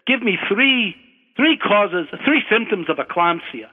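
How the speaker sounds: put-on examiner's question in a light Scottish accent, starting high. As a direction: imitate a Scottish professor, lightly. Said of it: "try to do my Scottish accent".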